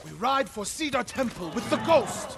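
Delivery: high, loud battle cry; rallying shout. Acted. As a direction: rouse the warriors to ride.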